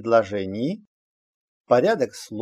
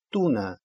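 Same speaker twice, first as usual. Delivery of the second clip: туна stressed on ту, falling away on на; stress on penultimate syllable; 2 semitones lower than usual. Shown as a demonstration. Teacher shows the exception for learners, stress on ту in туна. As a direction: hit ту in туна and let на drop. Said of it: "на предпоследний слог".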